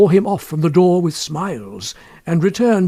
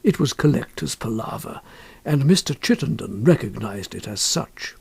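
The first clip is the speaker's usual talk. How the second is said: ordinary delivery, nothing marked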